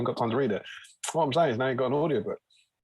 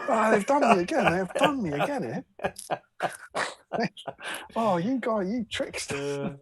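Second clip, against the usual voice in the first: higher, hammed-up cry of being cheated; mock betrayed drinker; 8 semitones higher than usual. Cohost playing a cheated drinker, high and hammed-up. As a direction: whine like a mug who got fleeced.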